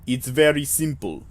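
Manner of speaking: put-on Russian clerk voice; faux Russian accent. Clerk explaining procedure to visitor, faux Russian accent. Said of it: "a, faux Russian accent".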